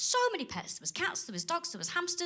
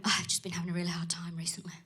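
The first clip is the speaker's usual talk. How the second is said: imitating her breathy secret-sharing whisper; hushed whisper; 7 semitones lower, 6 dB quieter, faster than usual. Comic imitating a woman confiding her troubles in a whisper. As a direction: whisper it like a confession, fast.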